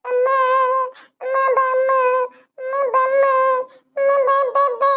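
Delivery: put-on high-pitched toddler whine; crying little girl. Child begging mother to come, high pleading cry. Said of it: "ಅಳ್ಬೇಡ ನನ್ ಮುದ್ದು ಬಂಗಾರ".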